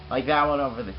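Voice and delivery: ordinary talk, nothing marked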